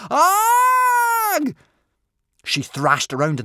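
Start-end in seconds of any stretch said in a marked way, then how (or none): 0.1-1.6 long, very high, loud shriek; sudden full-blast scream; piercing scream. A narrator's reading. Girl seized in the sea, piercing scream. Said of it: "let out a piercing scream".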